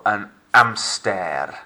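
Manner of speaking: an hamster slow, silent h, French amstair. Shown as a demonstration. Teacher Frenching an English word for pupils, slow. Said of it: "I'm Frenching it up".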